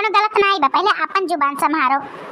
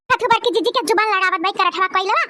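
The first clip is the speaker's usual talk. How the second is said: ordinary talk, nothing marked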